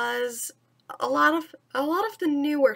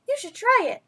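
put-on higher, eager boy's voice; 9 semitones higher, 4 dB louder, faster than usual. Mowgli urging Bagheera to join, high eager boy. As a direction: pitch Mowgli up, keen. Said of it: "Mowgli told him".

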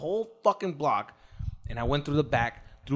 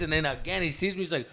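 ordinary talk, nothing marked

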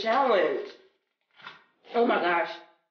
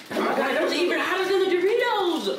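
laughing in pain; 9 semitones higher than usual. Real, not acted. Burning mouth, laughing.

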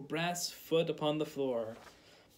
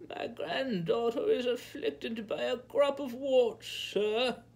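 put-on old peasant woman; 8 semitones higher, slower than usual. A narrator's reading. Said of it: "she told him".

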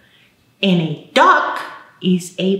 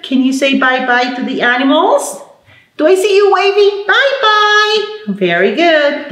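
cheerful goodbye; 8 semitones higher than usual. Real, not acted. Teacher saying bye-bye to the kids, high and cheerful. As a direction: wave them off with big cheer. Waving goodbye to small children.

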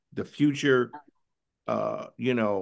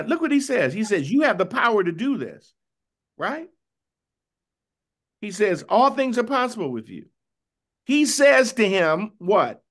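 much higher and louder, urgent, pressing each quote home; fervent preaching; 10 semitones higher, 5 dB louder than usual. Acted. Teacher to the class, dramatizing Jesus' plea with fervor.

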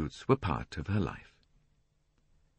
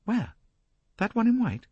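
eager. A narrator's reading. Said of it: "her sulkiness quite dropped".

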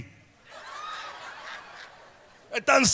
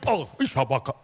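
mock grumble; 10 semitones lower, 6 dB quieter, faster than usual. Imitando queja de cristiano.

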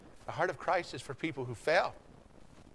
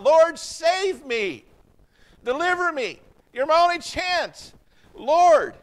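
much higher and louder, drawn-out pleading cry; desperate cry; 13 semitones higher, 11 dB louder, slower than usual. Retold or imitated. Peter sinking cries out to the Lord, desperate loud plea. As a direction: cry out for rescue as you go under. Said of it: "And he cries out".

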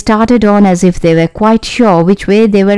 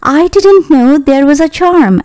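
put-on higher-pitched, lightly surprised girl; a touch of surprise, pitched up; mild surprise; 7 semitones higher than usual. A narrator's reading. Dorothy learns of the charm, mildly surprised. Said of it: "answered Dorothy in surprise".

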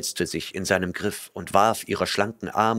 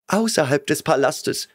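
higher, whimpering answer; frightened girl whimpering; 7 semitones higher than usual. A narrator's reading. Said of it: "wimmerte sie".

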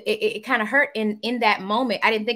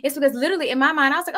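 usual speaking voice

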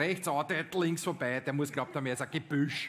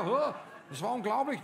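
viel höher, gesanglich; Schlagersänger-Stimme; 8 semitones higher than usual. Acted. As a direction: wie ein Schlagerstar trällern. Wie ein deutscher Interpret. (hohe Sängerstimme eines Schlagerinterpreten)

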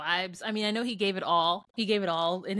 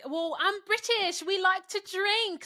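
put-on high British accent; Ekin-Su impression; 9 semitones higher than usual. Podcaster imitating a cast member who likes to drink, high British accent. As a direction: mimic a British woman in a high-pitched voice. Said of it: "Sweet Baby Ekin-Su was like".

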